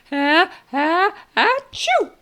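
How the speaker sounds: loud, high, hammed-up build to a sneeze; fake sneeze. Shown as a demonstration. Therapist faking a big sneeze for a toddler, hammed-up. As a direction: ham up a sneeze for a baby. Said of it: "this is what you do with babies".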